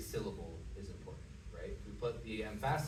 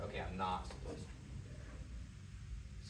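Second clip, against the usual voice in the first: quiet reminder; 5 semitones lower, 7 dB quieter, much slower than usual. Retold or imitated. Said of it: "that's a good way to remember".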